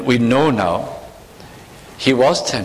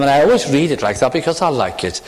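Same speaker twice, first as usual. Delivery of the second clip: ordinary delivery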